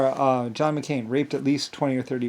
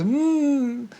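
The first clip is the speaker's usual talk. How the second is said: high protesting hum; 13 semitones higher, 3 dB louder than usual. Retold or imitated. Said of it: "she goes".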